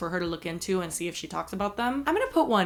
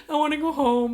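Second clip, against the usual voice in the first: whiny; 7 semitones higher, 4 dB louder than usual. Retold or imitated. Mortified, begging boyfriend to leave.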